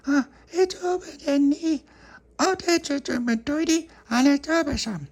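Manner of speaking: put-on very high toddler babble; baby voice. Baby Lucia speaking.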